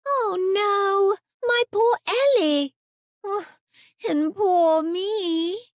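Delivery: put-on a lost small girl lamenting.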